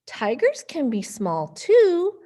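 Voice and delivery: put-on lively storybook voices for kids; reading to children. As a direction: read it playfully to little ones. Reading picture book to kids.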